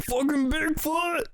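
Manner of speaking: high, drawn-out yell of mock terror; panicked yell. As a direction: shriek it like you've just seen Bigfoot. Tripper sees Bigfoot.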